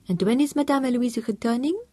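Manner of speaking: a hint of French maid; French housekeeper voice. A narrator's reading. Housekeeper asks Tom about his wife, lightly French.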